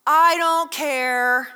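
mock indifference; drawn-out I don't care. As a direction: drag out "I don't care" like you really don't. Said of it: "what is the response?".

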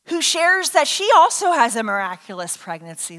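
normal talking voice

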